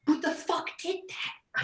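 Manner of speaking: very high outraged shout; furious yell. Acted. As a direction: demand who did it. Angry at spilled books.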